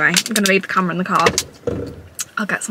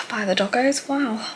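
ordinary talk, nothing marked

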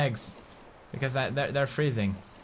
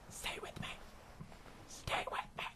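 breathless mock deathbed whisper; hammed-up farewell whisper; dying whisper; 21 dB quieter than usual. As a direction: whisper it like your last breath.

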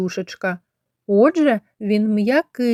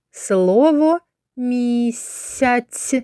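very slow, clear "Слово місяць"; slow teacher's dictation; slow word naming; 3 semitones higher, much slower than usual. Shown as a demonstration. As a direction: sound out the word for children. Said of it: "teacher sounding out the word".

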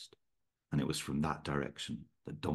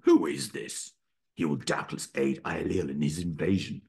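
suspicious king; 3 dB louder than usual. A narrator's reading.

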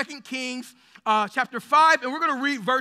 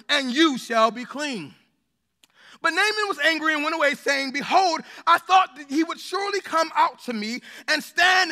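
measured, raised voice reading the Bible passage; scripture reading; 3 semitones higher, 4 dB louder than usual. Acted. Pastor reading Second Kings to the congregation, solemn and raised. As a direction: read it out like holy text to the church.

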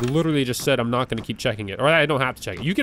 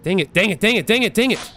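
escalating frustration; 5 semitones higher, faster than usual. Real, not acted. Player as the game goes wrong, escalating frustration. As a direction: pitch climbing with each repeat.

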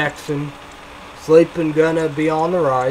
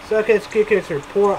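ordinary talk, nothing marked

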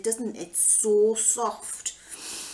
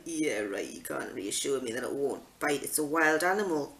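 low, dry and sarcastic, much lower; sarcastic disbelief; 5 semitones lower, 4 dB quieter than usual. Retold or imitated. Mum scoffing at the handler's reassurance, low and sarcastic. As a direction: scoff at his promise it won't bite.

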